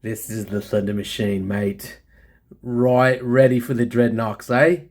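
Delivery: put-on a light, joking Australian accent; mock Australian accent. A light Australian accent, greeting his friends down under. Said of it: "I'll stop with my stupid accent".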